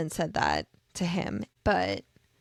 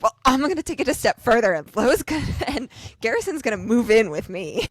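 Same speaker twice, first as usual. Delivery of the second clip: excited one-upping; 3 semitones higher, 6 dB louder, faster than usual. Retold or imitated. Lo outdoing Connor with the new roommate, excited boast. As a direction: jump in eagerly to outdo a rival.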